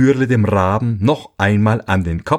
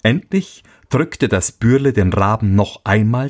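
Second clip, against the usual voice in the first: plain reading voice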